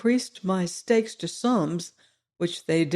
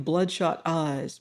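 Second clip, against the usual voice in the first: plain reading voice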